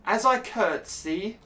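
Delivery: prim noblewoman, slow and lofty; mock posh lady. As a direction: announce the curtsy like a prim noble. Reading dialogue in posh voice.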